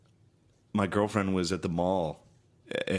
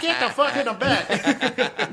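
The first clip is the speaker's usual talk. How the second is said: much higher, slow, laughing bus driver voice; bus driver lady; 6 dB louder, much slower than usual. Retold or imitated. Friend joking how the bus driver would bark, laughing. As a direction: ham up the bossy bus driver through laughter.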